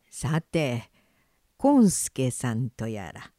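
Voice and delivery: put-on low, slow sly old man; creaky wise old man. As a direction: coax the fox with a wink. Traveller tricks the fox.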